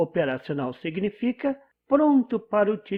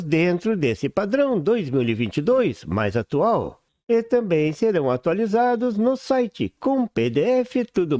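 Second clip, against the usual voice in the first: put-on rapid old-time reporter; 2 semitones lower than usual. Newsboy-style announcement of new series.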